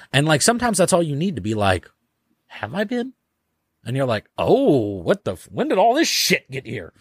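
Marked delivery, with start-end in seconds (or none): 2.5-3.1 high-pitched puzzled question; acted surprise. Acting out a puzzled realization. 4.4-6.9 high, mock-bewildered discovery; acted astonishment. Acting out sudden discovery.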